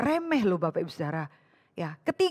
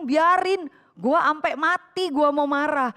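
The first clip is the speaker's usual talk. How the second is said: higher, slow, stubborn Jakarta slang retort; stubborn defiance; 5 semitones higher, 4 dB louder, slower than usual. Acted. Jonah answers God, stubbornly refusing to drop his anger. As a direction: dig in and refuse to let it go. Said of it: "Dia bilang".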